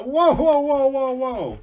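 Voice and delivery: high, loud run of whoas; hammed-up startled holler; alarmed halt. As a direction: yell as if skidding to a stop. Hunter skids to halt at river.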